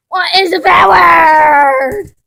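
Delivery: high drawn-out wailing shout; what is a bad word, whined and loud; crying protest. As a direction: wail it in disbelief. Cat protests punishment.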